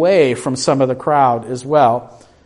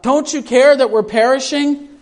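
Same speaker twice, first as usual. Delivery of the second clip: frightened plea; 8 semitones higher than usual. Acted. Disciples wake Jesus in storm.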